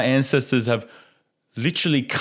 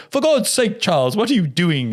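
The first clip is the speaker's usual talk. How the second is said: British teacher; 8 semitones higher than usual. Retold or imitated. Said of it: "British school teacher dude".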